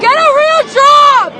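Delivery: protest yell. Real, not acted. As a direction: shout it across the street, furious. Yelling at police during protest.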